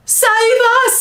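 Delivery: high, loud terrified scream. A narrator's reading. Giant begging for help in a nightmare, screamed. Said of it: "screamed the flesh lump eater".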